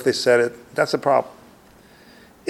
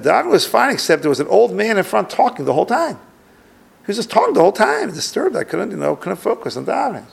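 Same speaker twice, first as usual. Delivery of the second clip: higher, a touch put-on voice of an annoyed visitor; complaining guest; 6 semitones higher than usual. Guest tells the questioner the davening was disturbed, mildly annoyed voice. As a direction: grumble about the old man talking. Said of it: "And he said".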